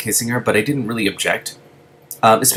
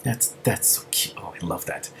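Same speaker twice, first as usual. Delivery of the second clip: cooing whisper; 10 dB quieter than usual. Real, not acted. Reader's aside about cute scene.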